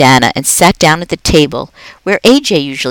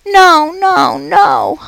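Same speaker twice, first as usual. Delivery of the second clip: higher, squeaky no, no, no; squeaky protest; much slower than usual. Acted. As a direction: squeak in dread of the dark. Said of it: "I squeaked".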